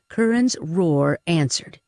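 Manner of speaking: ordinary delivery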